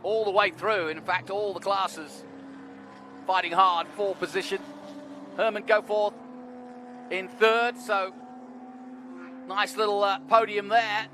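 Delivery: measured live race play-by-play; motorsport commentator. Real, not acted. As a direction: call the positions over the track.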